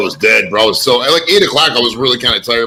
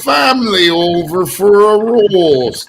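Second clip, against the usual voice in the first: slow, higher hammed-up Canadian, stretched "roast"; mock Canadian accent; 6 semitones higher, much slower than usual. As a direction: play a cartoon Canadian hosting dinner. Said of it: "That's not Canada".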